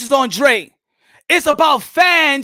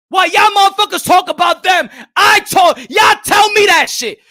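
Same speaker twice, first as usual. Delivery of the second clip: higher, louder outraged rant; angry shouting; 4 semitones higher, 5 dB louder than usual. Real, not acted. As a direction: yell the accusation at the critics.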